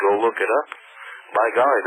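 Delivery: ordinary talk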